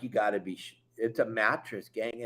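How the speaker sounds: quietly stunned report to the crew; deflated disbelief. Retold or imitated. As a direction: mutter it low like you can't believe it. Surfacing, seeing the mattress.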